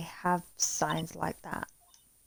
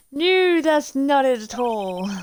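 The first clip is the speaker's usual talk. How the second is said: loud, slow protest; emphatic denial; 6 semitones higher, 9 dB louder, slower than usual. Acted. Lawyer corrects a suspicious photographer, emphatic denial. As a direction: protest it loudly, drawing out the words. Lawyer hastily denies threatening a lawsuit.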